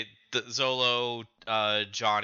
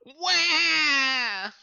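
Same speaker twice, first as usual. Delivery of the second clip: high, drawn-out mock wail; pathetic wail; 12 semitones higher than usual. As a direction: imitate a pitiful bawling kid. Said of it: "like, pathetic".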